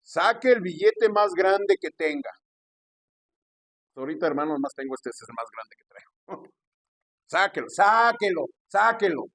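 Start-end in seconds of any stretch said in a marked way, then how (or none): 0.0-2.3 loud, drawn-out demand in a raised voice; slow, insistent mock order; imitated pushy pastor. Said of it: "con ese acento y ese tono de voz". 7.3-9.2 loud, stretched-out repeated command; slow mock order, pitched up; imitated pushy pastor. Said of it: "con ese acento y ese tono de voz".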